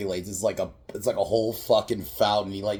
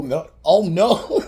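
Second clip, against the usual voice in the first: a slow, high, loud cry of "no, oh no"; mock horror; 10 semitones higher, 11 dB louder, slower than usual. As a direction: ham up the panic.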